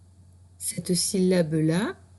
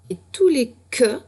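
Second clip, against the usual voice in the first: tous les que, plus haut et plus fort; insister; 6 semitones higher, 6 dB louder than usual. Shown as a demonstration. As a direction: souligner la règle. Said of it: "consigne appuyée". (consigne appuyée de maîtresse)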